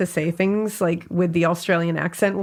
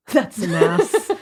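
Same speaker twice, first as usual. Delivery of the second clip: slow, laughing Scottish "That's an ass"; Scottish accent; slower than usual. Shown as a demonstration. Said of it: "in a Scottish accent".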